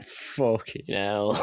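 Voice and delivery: slow, dragged-out stunned curse; deadpan disbelief. Retold or imitated. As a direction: let it out slowly, utterly stunned. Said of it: "I'm just there like".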